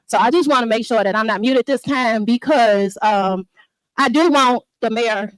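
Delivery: loud, close-miked public comment; real fired-up yelling at the mic; angry resident yelling at a village meeting. Real, not acted. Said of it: "you lose a lot of what she's saying when she's yelling".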